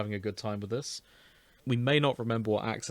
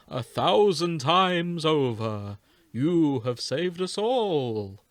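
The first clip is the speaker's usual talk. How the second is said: very slow, swelling louder to the end; hammy noble Meadow; 5 dB louder, slower than usual. Acted. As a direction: ham it up like a grand elder. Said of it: "More ham than a fucking deli counter".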